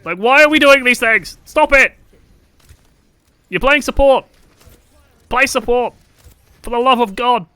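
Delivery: high, loud frustrated shouting; exasperated yelling. Real, not acted. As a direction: yell at them to play support.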